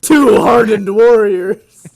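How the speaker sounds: hammed-up epic narrator, slow and loud; mock epic narrator. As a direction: announce it like an epic movie trailer. Mock-dramatic narration of cutscene.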